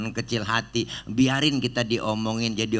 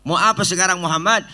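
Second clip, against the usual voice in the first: high and loud, out of patience; loud high exasperated question; exasperated angel; 8 semitones higher, 6 dB louder, faster than usual. Acted. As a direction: demand an answer impatiently. Exasperated Jibril asks.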